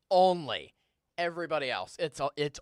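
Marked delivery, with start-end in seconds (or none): 0.1-0.7 "only" hit loud and higher, falling; emphatic closing "only"; stressed word. Real, not acted. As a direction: slam down the final "only". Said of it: "and I stress the word only".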